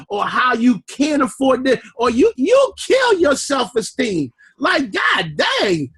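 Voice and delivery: normal talking voice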